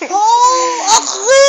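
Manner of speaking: very high, loud squealed Agi; high-pitched, drawn-out playful mimicry. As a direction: squeal it like a baby to get a giggle. Mimicking baby's squeal to get laughs.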